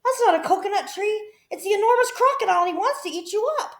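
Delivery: high, loud and fast alarm; urgent warning shout. A narrator's reading. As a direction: rush the warning out. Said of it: "shouted Humpy Rumpy".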